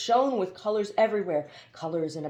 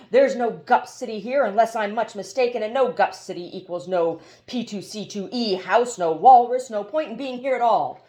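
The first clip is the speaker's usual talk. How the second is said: louder, higher, rising outrage; indignant protest; 4 semitones higher, 7 dB louder than usual. Acted. Haroun protests angrily.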